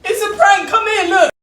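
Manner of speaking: very high, very loud prank reveal; laughing shout. Real, not acted. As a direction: shout it out while still laughing.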